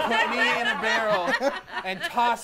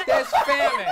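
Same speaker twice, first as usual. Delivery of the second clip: "famine" squealed much higher and louder through a laugh; 9 dB louder, slower than usual. Acted. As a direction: squeal "There's famine." high, cracking into laughter.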